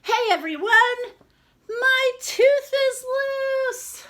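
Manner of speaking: put-on a small girl bursting to tell everyone; excited little girl. Madlenka announcing her loose tooth.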